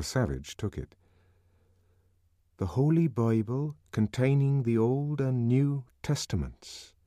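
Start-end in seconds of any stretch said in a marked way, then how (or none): 2.6-6.9 higher, slowed reading of a title page; title page read aloud, pitched up. A narrator's reading. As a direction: read the title out carefully in the savage's voice. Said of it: "he read aloud from the title page".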